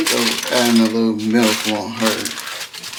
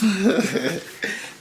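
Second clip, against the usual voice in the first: laughing; 9 semitones higher than usual. Real, not acted. Laugh between calls.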